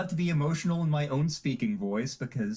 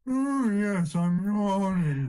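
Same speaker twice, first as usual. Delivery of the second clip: higher, drawn-out yawny voice; yawning voice; 4 semitones higher, 3 dB louder, much slower than usual. Shown as a demonstration. Listing voices that must get emotional, yawning voice. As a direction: draw the words out like a yawn.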